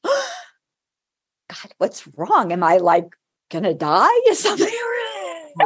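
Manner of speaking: high gasped "God", then laughing on "Is something wrong"; half-joking panic at a crying student; mock alarm. Teacher seeing her student tear up, mock alarm.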